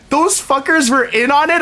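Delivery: high, rising disbelieving question; incredulous mock outrage. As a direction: ask it high, as playful outrage. Friend learns who was in on it.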